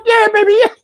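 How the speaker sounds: very high squealed mock cheer; hammed-up high-pitched exclamation; mock excitement. Acting out gleeful reaction in story.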